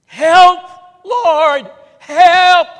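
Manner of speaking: full-throated high scream to God; piercingly loud, pleading shout; desperate cry for help. Acted. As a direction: scream out each word as a desperate plea.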